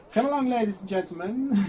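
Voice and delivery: high, loud air hostess announcement; stewardess voice. Retold or imitated. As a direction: flight attendant calling passengers aboard. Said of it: "stewardess voice".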